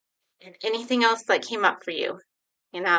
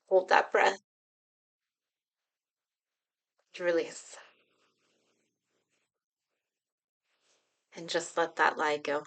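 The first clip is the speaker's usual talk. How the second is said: guided meditation. Acted. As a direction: ease them gently into the feeling. Leads a guided breathing exercise.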